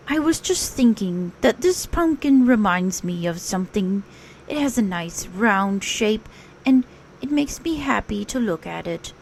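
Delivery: a dreamy, simple little bear; Pooh. Acted. Pooh telling Rabbit about his pumpkin, dreamy bear voice.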